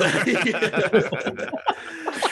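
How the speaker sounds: high, loud burst of laughter; the comic laughing at his own story's end. Real, not acted. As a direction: laugh hard at the punchline.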